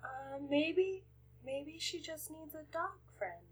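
very soft, hesitant suggestion; shy, awkward. Acted. Shy student shuffling feet among gossiping friends, awkward. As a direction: offer it timidly, almost to yourself. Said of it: "I'll shuffle my feet".

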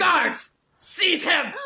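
high commanding shout; evil queen. Acted. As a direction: bark orders in a shrill imperious voice. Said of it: "I'll turn into Trant Thumble".

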